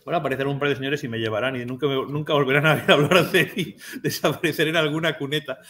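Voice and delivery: talking through laughter; laughing. Real, not acted. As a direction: joke about your own disappearance, breaking into laughter. Joking about being taken away.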